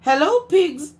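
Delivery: high bright chirpy hello; cheery duck greeting. Acted. Duck calls out to Cow as he rides past, high and cheery. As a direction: greet like a happy little duck on a bike.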